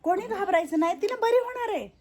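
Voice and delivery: high, loud call not to be afraid; loud comforting. Real, not acted. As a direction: lift the voice to calm the whole room. Calming the crying residents loudly.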